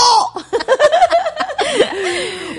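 bursting into high laughter; a real laugh, high and free. Real, not acted. Laughing at sleepy joke.

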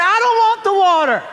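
hammed-up high shout; disproportionate anger. Husband refusing water from his wife, mock-enraged shriek. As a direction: yell the refusal in comic hysteria. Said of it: "in a disproportionate kind of a way".

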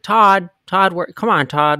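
loud calls for a lost man; calling Todd among the trees. Retold or imitated. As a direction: shout the name into the woods. Calling out in the woods.

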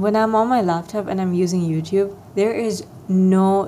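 ordinary talk, nothing marked

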